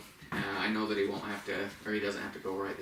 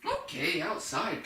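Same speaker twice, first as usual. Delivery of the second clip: high-pitched cue, "outside" lifted then falling; stress on OUTSIDE; 7 semitones higher, slower than usual. Shown as a demonstration. Trainer cueing a dog at the door, emphasized "outside". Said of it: "Start emphasizing the word outside".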